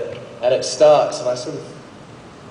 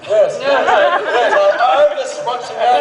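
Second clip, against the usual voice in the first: higher, slow, drawn-out mock agreement; sarcastic self-mockery; 12 semitones higher, slower than usual. Actor mocking himself for watching his own film, slow and high. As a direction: play along with the whisperers, deadpan and slow. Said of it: "I'm sitting there going".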